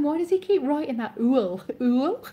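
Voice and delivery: high, loud mock bafflement; hammed-up puzzled questioning; baffled self-quote. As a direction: imitate your own confusion, pitched right up. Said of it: "I was going".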